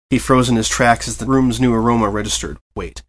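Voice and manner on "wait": ordinary delivery, nothing marked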